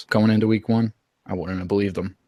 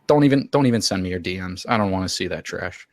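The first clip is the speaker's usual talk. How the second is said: ordinary delivery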